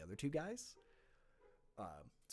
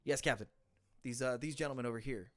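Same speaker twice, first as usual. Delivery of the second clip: crisp, louder military report; snappy sergeant; 4 semitones higher, 10 dB louder than usual. Acted. Sergeant reporting to the captain, crisp and loud. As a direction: report smartly to the officer. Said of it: "The sergeant goes".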